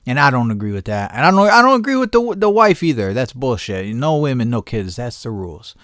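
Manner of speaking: ordinary talk, nothing marked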